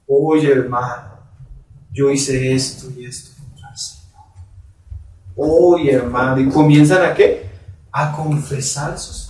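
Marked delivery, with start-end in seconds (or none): 0.1-6.4 imitación suave de alguien que confiesa entre lágrimas, más bajo y lento; imitando a un arrepentido. Retold or imitated. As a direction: confiesa con pena contenida, sin llorar de verdad. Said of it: "y a veces llorando". (imitación ligera de un arrepentido que confiesa)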